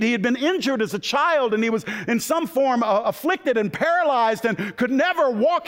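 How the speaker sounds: high-pitched, full-force sermon storytelling; fired-up preaching. Real, not acted. Preacher tells the church of the king's kindness, fired-up preaching. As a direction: preach it high and driving, line after line.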